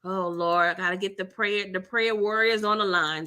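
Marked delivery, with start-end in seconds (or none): none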